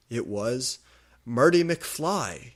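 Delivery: plain reading voice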